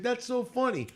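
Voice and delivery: high-pitched imitation of a British accent; lightly mimicked British accent. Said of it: "she said it in this British accent".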